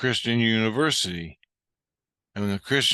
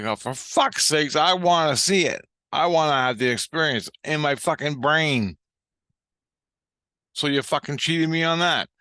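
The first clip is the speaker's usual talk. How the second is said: raised, heated protest; worked-up, high-pitched griping; aggrieved complaint; 4 semitones higher than usual. Retold or imitated. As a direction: demand what you feel cheated of. Retold complaint of being cheated.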